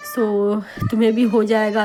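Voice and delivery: ordinary delivery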